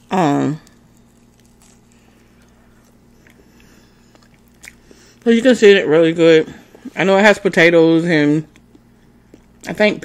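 words muffled by chewing; talking while chewing. Real, not acted. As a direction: mouth partly full of food. Seen 3: Bit samosa just before; mouth looks chewing during speech.

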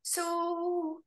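high, drawn-out hesitant "um, so"; tentative group-chat opener. Retold or imitated. Texting the neighborhood group chat, tentative and drawn out. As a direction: stretch it out, feeling out the neighbors. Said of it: "And I was like".

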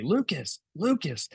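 retold holler at modest volume; mock yell. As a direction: imitate a contractor calling out a name. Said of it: "The contractor yells for me".

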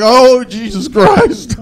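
high, long drawn-out wail; panicked cry. Retold or imitated. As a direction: stretch it out in mock panic. Acted panic in reply to girl.

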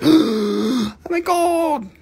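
high, loud, slow drawn-out awe; mock shock. Host imitating the world reacting to aliens, hammed-up shock. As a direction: gasp it out like you've seen aliens. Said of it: "everybody's gonna be like".

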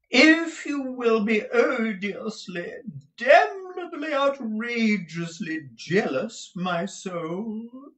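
high, drawn-out, hammed-up lament; theatrical husband wailing extravagantly at his wife; affected Mantalini character. A narrator's reading. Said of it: "said Mister Mantalini".

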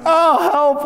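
high, playful squeal of help; giggly mock cry for help, pitched high; laughing call for help. Shown as a demonstration. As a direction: call out like losing a tickle fight. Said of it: "almost laughingly".

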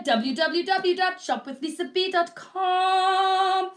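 slow sing-song web address; home shopping host. Real, not acted. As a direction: chant the website like a TV host. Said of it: "reminding viewers of website".

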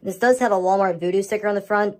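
ordinary talk

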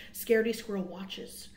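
ordinary delivery, nothing marked

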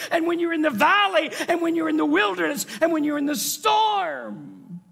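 building to high, loud declaration; impassioned promise. Acted. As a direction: preach God's promise with rising fervour. God's promise building in intensity.

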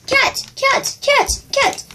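high excited squeals of cat; excited calling out. Real, not acted. Spotting a cat in the game, high excited squeals. Spots cat, calls out repeatedly.

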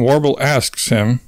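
usual speaking voice

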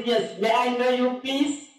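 slow, rising polite query. Acted. Asking the night caller's name, voice climbing. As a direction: lift the pitch towards PLEASE.

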